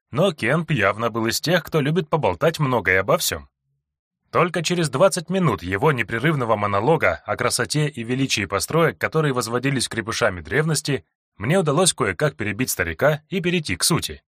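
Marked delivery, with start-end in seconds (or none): none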